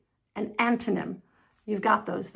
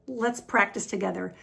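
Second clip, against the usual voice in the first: usual speaking voice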